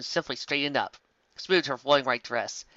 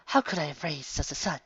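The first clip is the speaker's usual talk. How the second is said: sniffling; 2 semitones higher, 4 dB quieter than usual. A narrator's reading. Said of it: "She sniffled".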